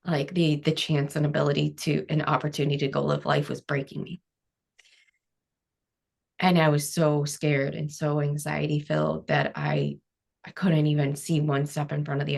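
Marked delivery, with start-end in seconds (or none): none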